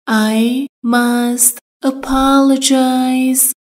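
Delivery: slow drill. Shown as a demonstration. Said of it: "باید عذرخواهی کنم".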